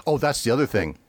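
usual speaking voice

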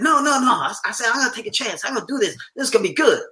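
retold overriding insistence; insistent protest. Husband waving off his wife's doubts, insistent.

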